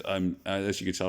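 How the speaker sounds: ordinary talk, nothing marked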